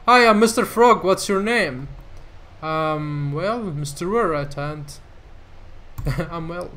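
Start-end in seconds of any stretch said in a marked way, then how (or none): 0.1-1.9 mildly higher, louder hammed-up puppet greeting; a light go at a cheery frog puppet. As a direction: player lightly voicing Mister Frog.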